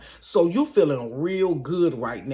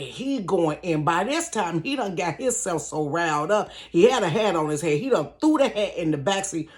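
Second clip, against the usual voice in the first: normal talking voice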